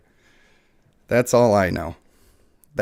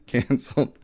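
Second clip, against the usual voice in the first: ordinary talk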